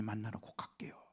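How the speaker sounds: soft, hushed vow; whispered farewell vow; tearful whisper. Retold or imitated. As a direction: whisper a promise to the departed. Promise to dead grandmother.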